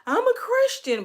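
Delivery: high, loud mimicking voice; mocking fake Christians. To church people who criticize her, mocking. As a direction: mockingly pipe up like a hypocrite. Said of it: "y'all do shit like this and be like".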